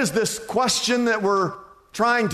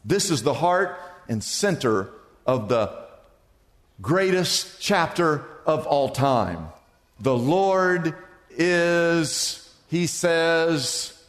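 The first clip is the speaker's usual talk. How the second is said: usual speaking voice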